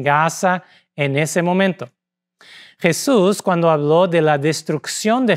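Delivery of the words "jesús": usual speaking voice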